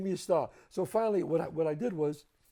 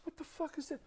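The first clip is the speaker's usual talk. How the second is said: puzzled disbelief; 11 semitones higher, 11 dB quieter than usual. Retold or imitated. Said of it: "I said".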